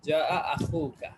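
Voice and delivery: slow, drawn-out Arabic recitation of যা আ আখুকা; teacher models যা আ আখুকা slowly for students. Shown as a demonstration. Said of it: "তাহলে কি হবে?".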